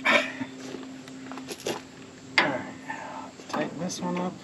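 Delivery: ordinary delivery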